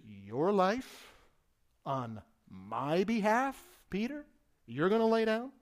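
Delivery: voicing Jesus, mildly incredulous, rising on Peter; incredulous mirror. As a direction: hold up a mirror to a ridiculous claim. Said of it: "show him how ridiculous".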